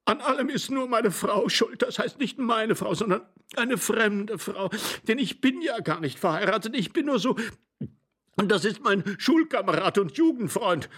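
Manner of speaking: high, tearful excuse-making; sobbing, failing voice. A narrator's reading. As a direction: blurt excuses in a high, whimpering voice. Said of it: "schluchzend mit versagender Stimme".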